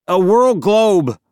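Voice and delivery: high, loud, drawn-out blurt. A narrator's reading. Boy covering for the Loculus, blurted. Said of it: "I blurted".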